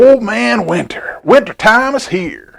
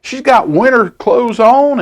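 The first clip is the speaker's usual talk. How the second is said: usual speaking voice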